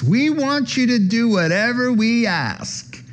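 high, put-on voice of pushy brothers; high mock voice of demanding disciples. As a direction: ask like spoiled kids.

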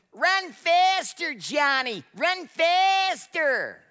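high, slow, loud yell from the stands; a mother screaming her son on, drawn out; screaming mom. Retold or imitated. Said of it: "and screamed".

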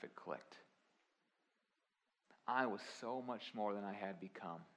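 usual speaking voice

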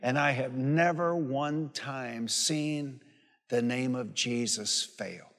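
slow, weighty testimony with long pauses; solemn preaching. Real, not acted. Preacher declaring to the congregation that Jesus' name never fails, slow and weighty. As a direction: let each phrase land like a vow.

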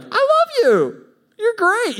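hammed-up unbothered lover; gushing kindness. Mock overly loving reply.

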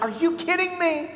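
high, pained disbelief; hurt exasperation. Retold or imitated. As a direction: cry it out at someone who hurt you. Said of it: "and you're like".

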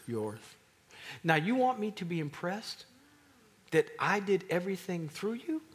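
loud, high, rising incredulous challenge; indignant confrontation. Acted. God confronting the braggarts, loud and incredulous. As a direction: demand an answer, building.

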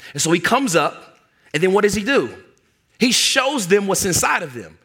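ordinary delivery

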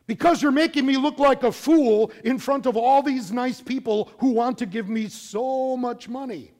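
high, loud furious rant; angry Balaam. Acted. Balaam shouting at his donkey, furious and loud. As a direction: snap at the donkey in rage. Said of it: "he starts arguing with the donkey".

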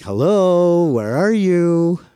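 high, slow teasing call into the dark basement; creepy sing-song call. Retold or imitated. Boy creeping into a basement to scare his friend, high teasing call. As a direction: draw out each word to spook a hiding friend. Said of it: "the other would come down and be like".

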